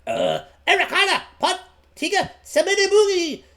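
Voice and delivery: high, halting goblin words; speaking goblin. Acted. Boy addressing the goblins in their tongue, high and deliberate. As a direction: call out goblinese in a high put-on voice. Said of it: "you speak goblin".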